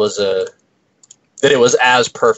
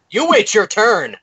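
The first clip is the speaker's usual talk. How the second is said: raised-pitch playful telling-off; mock scolding; 11 semitones higher than usual. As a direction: pretend to snap at a friend for butting in. Mock telling-off of interrupting friend.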